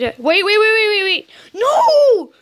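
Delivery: high, frantic frustrated yelling; angry outburst. Real, not acted. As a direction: explode at the game in a high, exasperated voice. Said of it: "I'm so angry right now".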